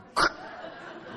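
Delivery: unvoiced breathy chuckle; breathy laugh. Real, not acted. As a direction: laugh under your breath. Laughing at own story.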